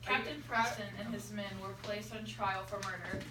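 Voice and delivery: normal talking voice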